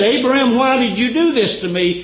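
high, loud accusing question; demanding question. Acted. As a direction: confront him loudly, pitch up. Abimelech questioning Abraham.

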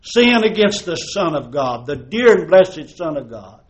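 normal talking voice

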